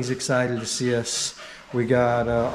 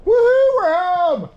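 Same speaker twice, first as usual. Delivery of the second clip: high, loud joyful whoop; excited cheer; 7 dB louder, slower than usual. Real, not acted. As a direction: cheer the homecoming. Arriving home after long trip.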